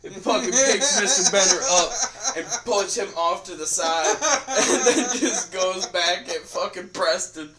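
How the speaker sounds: can barely get it out for laughing. Real, not acted. To his friend about Tori's fight, laughing.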